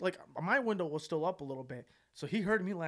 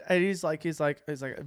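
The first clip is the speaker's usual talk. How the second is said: ordinary talk, nothing marked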